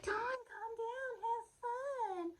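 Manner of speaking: very high, slow, soft whine; mimicked coaxing friend. Friend telling her to loosen up, mocking high voice. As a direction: draw it out high to mock the friend.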